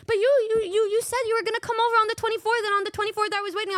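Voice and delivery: high, fast, pleading mock whine; whiny friend impression. Friend complaining she was waiting, mock whiny and fast. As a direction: rush out her hurt complaint. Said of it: "Then she was in".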